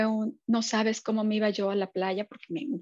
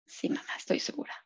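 daughter's whispered reply; 7 dB quieter than usual. Retold or imitated. Daughter answers her mother.

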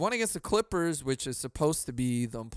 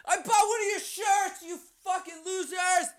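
mock fan shout. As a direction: scream at the TV like an angry fan. Yelling at TV like a fan.

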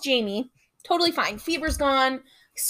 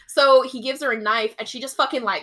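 normal talking voice

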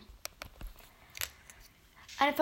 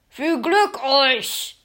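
Frank voice; 8 dB louder, much slower than usual. Acted. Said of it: "sagte Frank".

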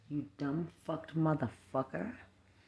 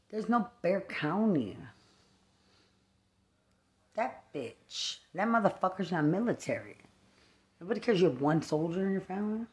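usual speaking voice

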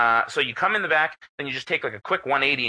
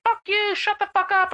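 much higher, shrill yapping shout from an angry neighbor; yappy neighbor. Retold or imitated. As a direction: bark it like a tiny chihuahua. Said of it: "You sound like a chihuahua".

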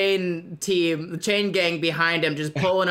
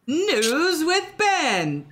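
slow, higher news-intro announcer; 7 semitones higher, much slower than usual. Acted. Host introducing his own news segment, announcer voice. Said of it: "is that what they sound like".

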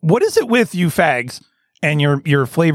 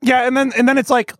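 ordinary talk

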